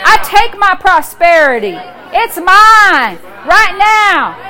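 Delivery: loud, slow commanding prayer; preaching at full force. Acted. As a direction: declare it like a preacher on fire.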